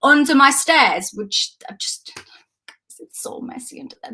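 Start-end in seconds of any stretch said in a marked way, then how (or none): 1.1-4.1 sudden whisper, much softer, like a confession; hushed embarrassed whisper; whispered aside. Real, not acted. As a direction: drop to a whisper to admit the mess. Embarrassed aside about messy stairs.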